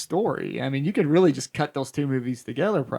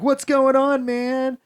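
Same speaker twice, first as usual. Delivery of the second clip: lightly voiced neighbor, much higher; casual neighbor; 9 semitones higher, slower than usual. Neighbor greeting Doctor Loomis in the street, lightly mock casual. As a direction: play it as a clueless chatty neighbor. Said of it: "He's like".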